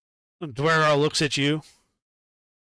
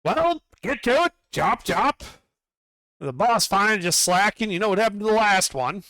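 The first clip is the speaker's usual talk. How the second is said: higher bossy overseer voice; Duergar foreman calling out; 7 semitones higher than usual. Acted. Duergar foreman calls out to Hebron, higher and bossy. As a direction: hail the new worker like a pushy dwarf boss. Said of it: "And he calls out".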